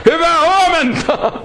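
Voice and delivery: high-pitched mock holler into a deaf ear; shouted greeting. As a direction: holler it as if to someone hard of hearing. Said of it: "ja huutaa".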